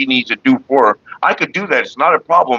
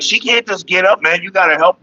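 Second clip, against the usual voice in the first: higher pitched mock of a pushy bus driver; imitating the driver who told him what to do, pitched up; 9 semitones higher than usual. Mocking the bus driver who brought mom home, high voice. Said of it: "And they're like".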